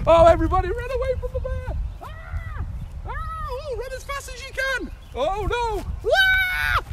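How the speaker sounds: high, loud playful screams of fear; hammed-up fleeing from a bear; mock panic. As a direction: pretend terror as the bear chases the class.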